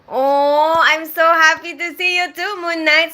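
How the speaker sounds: gushing. Real, not acted. Gushing reply to chat.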